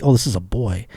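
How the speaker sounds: ordinary talk, nothing marked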